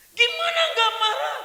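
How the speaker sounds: high, loud exasperated protest; father snapping at daughter; indignant father. Acted. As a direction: fire it back at her.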